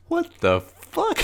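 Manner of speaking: very high, helpless laughing squeal; slow, baffled question dissolving into laughter; laughing disbelief. Real, not acted. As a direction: crack up in disbelief. Cracks up at the absurd line.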